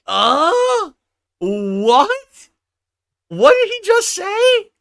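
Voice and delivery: loud, high, drawn-out cries of disbelief; floored, shouting what at the screen; stunned disbelief. Real, not acted. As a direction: react as if you can't believe what you just heard. Stunned reaction to the clip.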